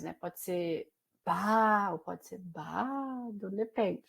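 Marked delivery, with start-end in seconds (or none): 0.1-1.9 slow, stretched "ba"; drawn-out ba. Shown as a demonstration. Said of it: "o ba, ele tem várias entonações". 1.9-3.5 "bado" said slowly and much softer, falling. Shown as a demonstration. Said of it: "o ba, ele tem várias entonações".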